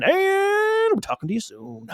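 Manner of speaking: high, slow radio announcer sign-off. Pretend. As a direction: croon the sign-off like a DJ. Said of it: "Get my radio voice on".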